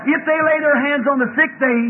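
high, loud revival preaching; full-force preaching. Real, not acted. Preacher exhorting the congregation to ride on the promise, preaching hard. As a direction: drive it home to the congregation, peaking in shouts.

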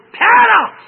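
high, loud triumphant cry; preaching shout. Real, not acted. Preacher exclaims the key word.

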